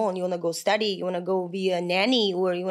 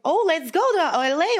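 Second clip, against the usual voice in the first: higher, louder, thrilled "let's go"; bursting with excitement about moving; retold eager excitement; excited; 8 semitones higher, 4 dB louder than usual. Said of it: "I was so excited".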